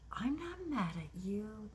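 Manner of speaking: a hint of the elderly mother brushing it off; the mother insisting she isn't upset, lightly voiced; mother's denial.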